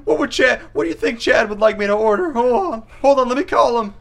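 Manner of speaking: high, anxious mock-meek voice; timid diner. Bullied diner worrying about Chad's approval, timid and high. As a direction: fret in a high put-on voice.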